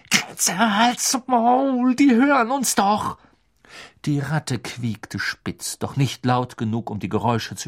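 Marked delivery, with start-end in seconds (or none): 0.1-3.2 hoch, lauter und sehr langsam; wütend. A narrator's reading. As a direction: fahr die Ratte wütend an. Ron snapping at rat. (Ron herrscht Kretze gedehnt an)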